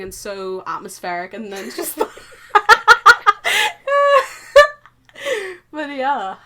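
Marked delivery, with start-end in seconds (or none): 2.0-4.7 long helpless high-pitched laughter. Real, not acted. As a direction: dissolve into laughter.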